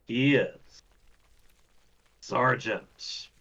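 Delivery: measured announcer intro, drawn-out names; race announcer. Pretend. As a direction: introduce the opponent slowly, pausing before each name. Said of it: "my race announcer voice".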